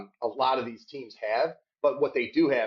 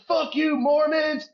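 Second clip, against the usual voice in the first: mock heckler yell, pitched way up and louder, slowed; 12 semitones higher, 3 dB louder, slower than usual. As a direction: bellow it like a drunk tailgate heckler. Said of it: "they're like".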